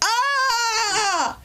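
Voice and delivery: shrieking high yelp; very high mock shriek of horror; mock panic. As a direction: scream like a head fell off. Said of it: "They're just like".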